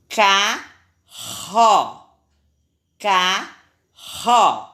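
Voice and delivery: CARRO split in two, strong RR. Shown as a demonstration. Teacher exemplifying RR between vowels, strong R in "carro". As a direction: sound out "carro" slowly with a strong double R. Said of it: "Quando sai o som forte".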